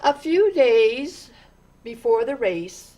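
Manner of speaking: ordinary delivery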